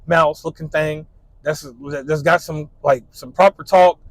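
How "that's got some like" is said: ordinary delivery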